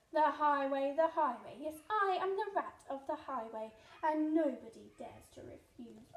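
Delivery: loud greedy rat demanding sweets; loud bandit rat. Acted. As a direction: demand it like a bully.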